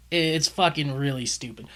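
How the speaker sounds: ordinary talk, nothing marked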